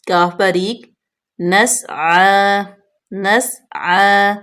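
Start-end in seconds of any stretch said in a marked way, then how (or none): 1.4-4.3 نَسْعَى with a whistling س and a long drawn عین; careful tajweed. Shown as a demonstration. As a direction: hiss the س and stretch the vowel of نَسْعَى. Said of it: "سین جو ہے اس پہ سیٹی کی آواز آئے گی". (نَسْعَى، سین میں سیٹی اور عین کو کھینچ کر)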